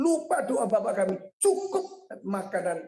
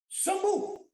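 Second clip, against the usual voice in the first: healing command; 12 semitones higher than usual. Retold or imitated.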